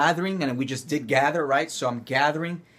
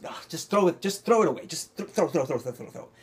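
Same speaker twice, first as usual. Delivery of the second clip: throw it away; 3 semitones higher than usual. Shown as a demonstration. Acting teacher demos throwing away.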